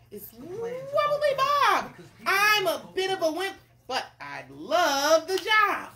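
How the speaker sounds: high, loud, stretched-out wobbly voice; wimpy cook. Acted. Wobbly Bob introduces himself to the king, wimpy voice. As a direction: play an eager wimp, drawing words out.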